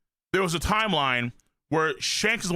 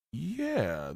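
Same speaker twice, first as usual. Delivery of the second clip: usual speaking voice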